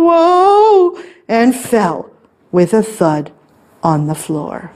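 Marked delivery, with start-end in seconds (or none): 0.1-1.0 high, loud drawn-out whoa; tumbling whoa. A narrator's reading. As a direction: cry out while spinning over. Monster spins and falls.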